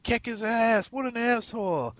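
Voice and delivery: high-pitched imitation of her taunting from behind; mock drunk girl yelling. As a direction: squeak it out like the angry girl egging him on. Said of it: "she's yelling at me".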